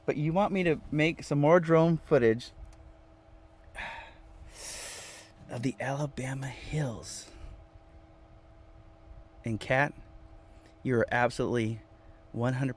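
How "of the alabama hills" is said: a breathy sigh sinking into a whisper. Real, not acted. To his viewers, after promising not to cry, a sighing whisper. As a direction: let the words fall away on a sigh, close to tears. Fighting tears over viewer request.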